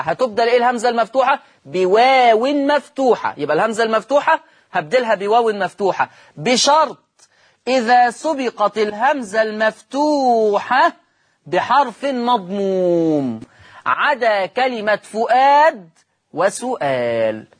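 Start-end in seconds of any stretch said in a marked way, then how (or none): 6.4-17.5 drawn-out, measured classroom dictation; slow dictation. Shown as a demonstration. Teacher dictates the rule's condition to students, slow dictation. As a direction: stretch each phrase out for note-takers.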